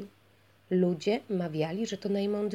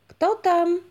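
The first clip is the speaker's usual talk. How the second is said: high, loud call through the gate; who's there, called out loud and high; call from behind the gate; 11 semitones higher, 9 dB louder than usual. A narrator's reading. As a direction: voice calling from inside the castle. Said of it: "odezwał się jakiś głos".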